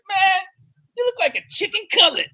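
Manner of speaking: high, loud ribbing call; jeering firehouse tease; mock teasing exclaim. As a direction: razz him like you're busting his chops. Teasing a lean coworker.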